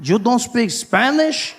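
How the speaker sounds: imitating a shocked Spanish speaker. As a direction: question him like he betrayed his people. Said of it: "Guy was like".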